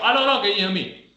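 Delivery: loud, high, fast call to an unresponsive person; demo shout. Shown as a demonstration. First aid trainer demonstrates shouting to a casualty, loud fast calling. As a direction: call out sharply as if checking he can hear you. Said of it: "you just shout".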